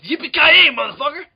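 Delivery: loud, high catchphrase yell; hammed-up whooping shout; Bruce Willis. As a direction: holler it like an unhinged action star. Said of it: "runs around all the time yelling".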